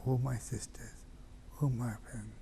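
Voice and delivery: whispered, grieving farewell; hushed farewell. Acted. Hussein whispering farewell to his sisters. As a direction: breathe it out as a last goodbye.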